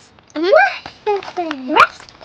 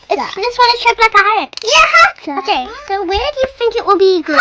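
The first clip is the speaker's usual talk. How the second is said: ordinary talk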